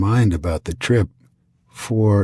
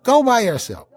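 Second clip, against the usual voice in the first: much higher mock wife voice; imitating the wife; 13 semitones higher than usual. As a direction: insist like a stubborn wife.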